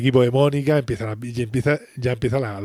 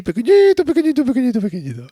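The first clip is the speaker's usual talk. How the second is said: mocking tiny; 12 semitones higher, 4 dB louder, faster than usual. Ross teasing Rachel about running far away, squeaky high. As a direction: squeeze the word up high to make it tiny.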